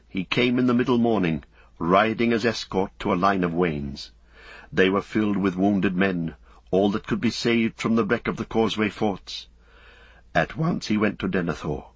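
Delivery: ordinary delivery, nothing marked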